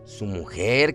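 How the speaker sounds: plain reading voice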